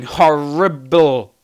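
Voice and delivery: "horrible" drawn out, starting like "horrendous"; blended mispronunciation. Real, not acted. Host signing off fumbles the word for his bad jokes, a blended mispronunciation. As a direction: stumble slowly from "horrendous" into "horrible". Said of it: "a cross between horrendous and horrible".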